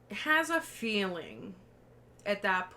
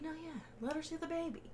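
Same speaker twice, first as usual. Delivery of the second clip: Roman's voice; 8 semitones higher, 8 dB quieter, faster than usual. Retold or imitated. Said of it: "Roman's like".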